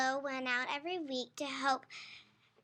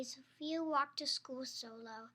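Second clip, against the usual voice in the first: ordinary delivery, nothing marked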